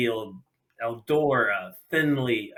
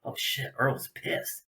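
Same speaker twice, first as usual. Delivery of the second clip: alarmed aside; 9 dB quieter, slower than usual. Retold or imitated. His alarmed aside on seeing Earl.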